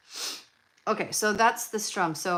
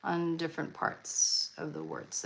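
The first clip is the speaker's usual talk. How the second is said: normal talking voice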